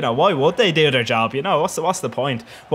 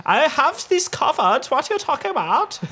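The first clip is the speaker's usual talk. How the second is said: Adler; 13 semitones higher than usual. Retold or imitated. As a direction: squeak it out as a mock goalkeeper retort. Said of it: "But Adler will tell you, you go".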